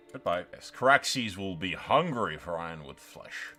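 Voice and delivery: ordinary delivery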